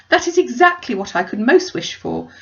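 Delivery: high, loud, eager cry; Mrs Bennet gushing. Acted. Mother answering her brother, high and eager. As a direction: gush with relief.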